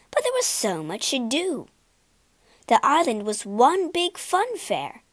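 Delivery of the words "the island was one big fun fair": plain reading voice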